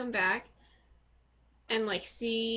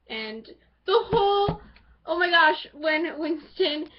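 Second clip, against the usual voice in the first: excited gush; 5 semitones higher, 7 dB louder than usual. Real, not acted. Gushing over shirtless characters.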